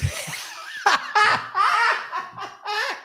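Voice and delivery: long high-pitched cackle; real, breathless laughing fit; helpless laughter. Real, not acted. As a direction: crack up completely. Cracks up at Steve's head shaking.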